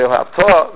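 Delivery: "Haftarah" as "ve'ahavta" with the final "ta" stressed; stress on last syllable. Shown as a demonstration. Teacher demonstrating the Shema word, last syllable stressed. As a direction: put the accent on the end of "Haftarah". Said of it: "means you should love".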